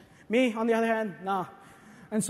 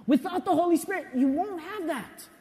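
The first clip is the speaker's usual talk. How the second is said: ordinary delivery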